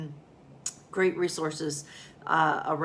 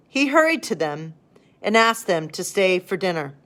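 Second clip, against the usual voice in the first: higher, louder storybook narration; story reading; 4 semitones higher, 6 dB louder than usual. A narrator's reading. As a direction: read it like a children's bedtime story. Reads Beginner's Bible aloud.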